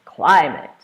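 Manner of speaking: CLIMATE with a loud burst on CLI. Shown as a demonstration. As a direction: raise your voice on the first syllable of climate. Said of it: "You can raise your voice".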